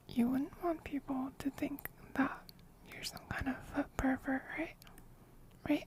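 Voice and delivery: soft ASMR whisper close to the mic; ear whispering. Real, not acted. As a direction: breathe it right into their ear. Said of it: "somebody else redeemed whispering".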